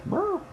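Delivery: high, strained mock roar; a silly cartoon roar; monster roar. Legendaries roaring back in a mock roar. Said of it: "And they're like".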